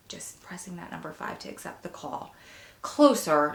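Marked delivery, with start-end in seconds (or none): 0.1-2.3 a soft unvoiced whisper, much softer. Real, not acted. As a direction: breathe it out tenderly, almost no voice. Tender ending to viewers.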